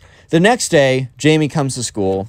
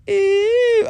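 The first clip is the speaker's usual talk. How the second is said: squealed high disgusted ew; a cry of disgust, very high; mock disgusted crowd. Crowd reacting to the bully's challenge, squealing high.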